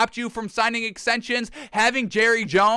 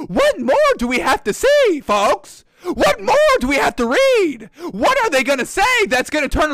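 much higher and louder, fed up; a fan losing patience, high and loud; exasperated outburst; 7 semitones higher, 5 dB louder than usual. Real, not acted. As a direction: throw the question out in disbelief.

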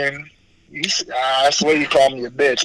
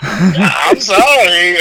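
mock apology; 4 semitones higher, much slower than usual. Real, not acted.

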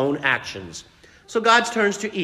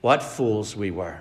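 low, soft, regretful; remorseful lament; 9 semitones lower, 5 dB quieter than usual. Acted. Adam and Eve mourn their foolishness, low and soft. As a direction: sigh the words in regret.

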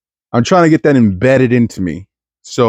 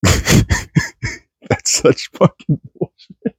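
whispered, laughing slowly; 6 semitones higher, 3 dB quieter, much slower than usual. Real, not acted. Comedian laughing at his own bit, whispered slowly. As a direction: laugh it out under your breath. Laughing aside under breath.

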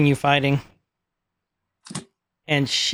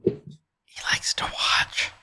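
breathy unvoiced whisper, very soft; a whispered creepy aside; 7 dB quieter than usual. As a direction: hush it like a sinister secret. Creepy aside about He That Remains.

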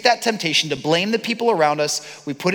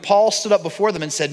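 ordinary talk

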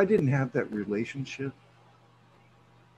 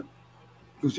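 normal talking voice

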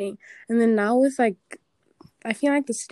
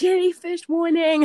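mock scream of warning, pitched up and drawn out; retold warning scream; 6 semitones higher, 3 dB louder than usual. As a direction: call out a slow, high mock alarm. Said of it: "like, screaming".

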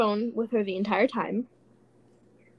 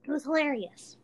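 normal talking voice

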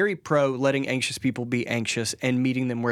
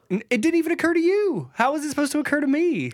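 indignant high; 12 semitones higher than usual. Real, not acted. Playful heated argument.

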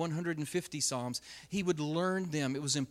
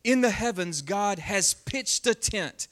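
louder, slow, proclaiming; dramatic scripture reading; 3 semitones higher, 5 dB louder, slower than usual. Acted. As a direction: declaim the psalm to the room. Reads psalm aloud with force.

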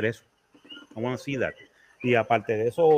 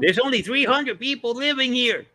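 high, far-off voice as a local; mock local voice; 11 semitones higher than usual. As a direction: playfully mimic a small-town local. Mocking a local's claim.

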